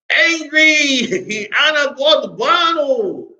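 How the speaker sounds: high-pitched, drawn out through laughter; real laughter breaking into the lesson; amused laughing. Real, not acted. Joking while teaching word for angry.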